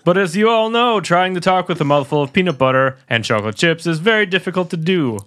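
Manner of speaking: hammed-up game show host intro; big mock TV announcer, slowed. Mock show intro about Margaret.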